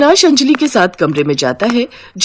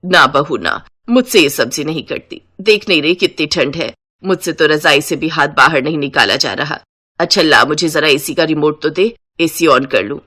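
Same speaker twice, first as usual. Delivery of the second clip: lower-pitched elder voice, making excuses; reluctant older relative, pitched down; work-shy mother-in-law; 4 semitones lower than usual. Acted. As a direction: beg off in a deeper character voice. Mother-in-law begging off chores.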